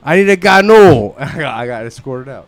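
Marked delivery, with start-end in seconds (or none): 0.0-1.1 loud, slow holler; imitating a loud drunk ordering=; retold drunken demand. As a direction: bellow the order, stretching the last word. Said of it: "I had a couple too many drinks".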